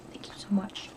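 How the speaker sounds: soft, breathy whispered thank you so much. Real, not acted. Thanking Kim for the card in a hushed whisper. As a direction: whisper the thanks close and intimate.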